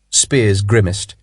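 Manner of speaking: plain reading voice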